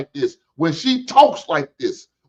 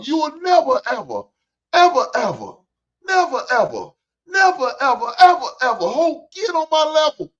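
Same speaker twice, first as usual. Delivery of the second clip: high, mocking repetition, each phrase falling off; mocking taunt; 10 semitones higher than usual. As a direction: repeat it high, rubbing it in. Mocking repeated taunt at her.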